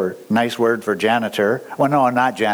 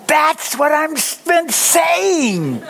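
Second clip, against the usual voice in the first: excited; 14 semitones higher, much slower than usual. Real, not acted. Excited preacher affirming listener.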